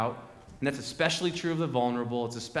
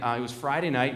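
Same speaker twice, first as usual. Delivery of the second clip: normal talking voice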